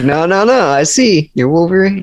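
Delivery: put-on high, awestruck kid voice; excited kid. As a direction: gasp it out like a thrilled kid. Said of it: "I'm like".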